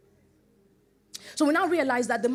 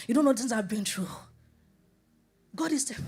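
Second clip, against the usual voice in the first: ordinary talk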